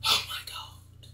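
breathless, slow whisper of disbelief. Real, not acted. Griping to viewers about bad accents, whispered. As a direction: barely breathe it out, appalled.

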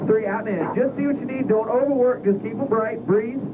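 high, driving callouts, breathe counts; urgent breathing coach. Real, not acted. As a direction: push the pilot through every breath. Instructor calls breathing during the G run.

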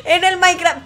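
high teary laugh into the words; laughing half-crying. Real, not acted. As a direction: laugh so hard it almost sounds like crying. Risa llorosa tras la burla. (risa llorosa y aguda)

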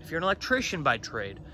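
ordinary talk

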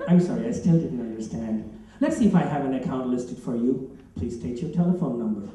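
low, measured voice-recognition robot; automated phone system. Acted. Phone system greets a caller, automated voice. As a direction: recite the menu as a low automated voice. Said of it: "an interactive voice recognition system".

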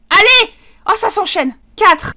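high, loud triumphant shout; excited cheer. Real, not acted. Gamer cheering as his Sims plan works, excited shout. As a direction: cheer it out, delighted.

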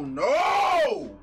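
high, loud disgusted no; disgusted shout. Real, not acted. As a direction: cry out in disgust. Disgusted outburst at the ketchup.

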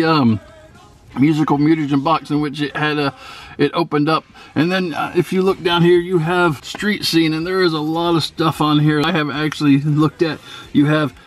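normal talking voice

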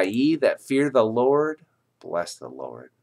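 normal talking voice

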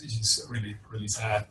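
usual speaking voice

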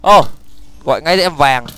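normal talking voice

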